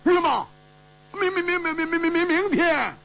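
high, stammering yelp; shocked outcry. A narrator's reading. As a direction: stutter in shock at a very high pitch. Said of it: "胡铁花跳起来失声说".

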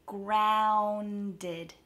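"Grounded" drawn out sound by sound; slowed down. Shown as a demonstration. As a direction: stretch the first vowel of "Grounded" slowly. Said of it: "practice slowing it down and hitting each".

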